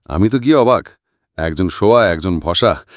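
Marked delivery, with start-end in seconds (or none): none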